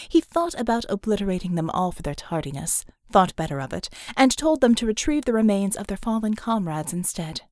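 ordinary delivery, nothing marked

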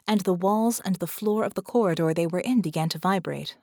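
ordinary delivery

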